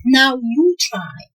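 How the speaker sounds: ordinary talk, nothing marked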